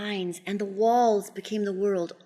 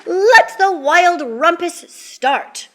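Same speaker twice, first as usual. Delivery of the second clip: high, loud, slow proclamation; Max crying out; 8 semitones higher, 8 dB louder, slower than usual. A narrator's reading. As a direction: proclaim it to the monsters, drawn out. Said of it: "cried Max".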